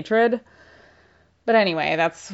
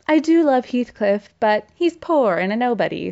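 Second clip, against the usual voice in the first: lightly voiced lovelorn lady, pitched up at first; a hint of dramatic confession; Catherine confiding; 4 semitones higher than usual. Catherine confessing to Nellie about Heathcliff, mildly dramatic. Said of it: "Catherine is like".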